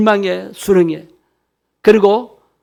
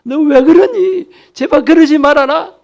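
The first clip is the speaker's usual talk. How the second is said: higher, exasperated mock nagging; hammed-up nag at a difficult person; 8 semitones higher than usual. Pastor mimicking nagging at someone we dislike, mock nagging. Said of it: "잔소리할 필요 없어요".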